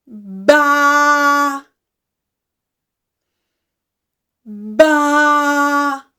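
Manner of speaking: loud, steady held baa; drawn-out demo sheep call; sheep bleat. Shown as a demonstration. Teacher demonstrates sheep sound for children.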